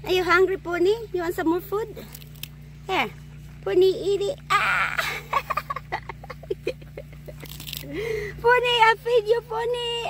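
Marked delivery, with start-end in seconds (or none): 4.5-7.8 sharp yelp into a nervous laugh; real startled squeal. Real, not acted. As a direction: jump back from the pony. Pony lunges at her food.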